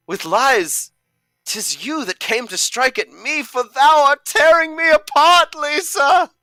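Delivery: a betrayed lover tearing the house down; over-the-top tragic shout of tearing me apart; Johnny's climax. Acted. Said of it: "So I am Johnny".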